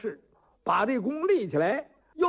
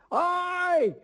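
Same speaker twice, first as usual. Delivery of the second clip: high, rowdy crowd yelling; heckling shout; 10 semitones higher than usual. Acted. Young onlookers egging Qi Guoyuan on, shouting high and rowdy. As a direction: egg him on like rowdy youths in a crowd.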